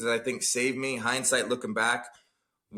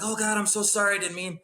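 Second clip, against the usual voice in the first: apologetic mom; 8 semitones higher than usual. Retold or imitated. Mother apologizing to her crying son, mildly mimicked. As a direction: gasp it out, horrified. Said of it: "my mom's like".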